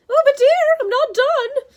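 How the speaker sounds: loud, high, fawning falsetto. A narrator's reading. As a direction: placate a monster in a false lady's voice. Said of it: "Grover said in his falsetto voice".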